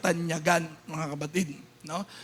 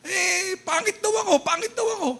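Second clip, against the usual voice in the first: high whiny child voice, mock sobbing; whimpering kid tattling to mom; crying child; 9 semitones higher than usual. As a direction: play the bullied little kid complaining.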